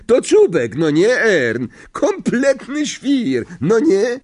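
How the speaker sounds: very high, excited character voice; incredulous conductor. Acted. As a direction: marvel at the madman, pitch soaring.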